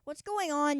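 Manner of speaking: ordinary delivery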